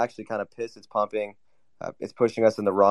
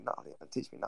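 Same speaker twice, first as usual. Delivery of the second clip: much softer, breathy near-whisper; whispered refusal; 18 dB quieter, much faster than usual. Real, not acted. Refusing the accent lesson from a friend, in a whisper. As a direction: drop it to a whisper, backing off.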